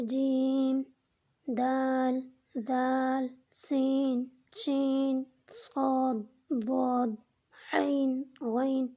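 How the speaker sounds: slow, each name held long; stretched three-letter names. Shown as a demonstration. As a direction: draw out every letter like a teacher. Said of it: "جو تین حروف سے مل کر ایک حرف بنائیں". (ہر حرف کو لمبا کھینچ کر پڑھیں)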